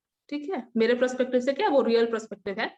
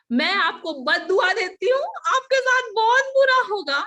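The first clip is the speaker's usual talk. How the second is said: higher and louder, hurling a curse; retold angry curse; 9 semitones higher, 7 dB louder than usual. She curses the uncle while leaving, loud angry curse. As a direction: throw the curse at him.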